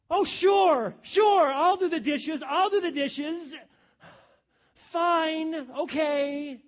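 loud, high, grudging shout of sure; sarcastic and resentful. Shown as a demonstration. Husband grudgingly agreeing to the dishes, loud sarcastic shout. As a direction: spit out the yes like an insult. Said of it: "you can say the same thing with a different tone and attitude".

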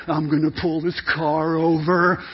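low-pitched mock dad warning; dad threat. Dad driving warns the kids, low threatening voice. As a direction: lay down a threat as a stern father. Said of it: "he makes that dad threat".

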